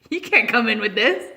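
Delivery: loud, laughing doorman's refusal; amused bouncer turning them away. Retold or imitated. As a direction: say it while cracking up.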